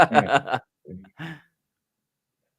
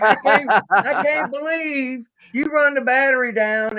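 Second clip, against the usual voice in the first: imitating complaining wife; 8 semitones higher, slower than usual.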